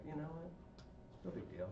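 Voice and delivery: quiet, easygoing wife voice; wife softly saying it's nothing; soft retold reassurance. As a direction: shrug it off gently. Retold wife's easy response.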